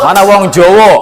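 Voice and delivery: mock Javanese accent, Jawa said as jowo with open o sounds; teacher calling out Javanese students in a playful Javanese accent. Shown as a demonstration. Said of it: "wong Jawa".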